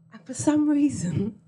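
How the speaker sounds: ordinary talk, nothing marked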